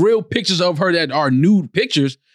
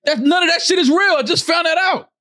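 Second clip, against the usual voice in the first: much higher, indignant outburst; worked-up protest; 11 semitones higher than usual. Real, not acted. Co-host upset that a loved documentary is fake, worked-up protest. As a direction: protest it high, as if just betrayed. Outraged over faked documentary.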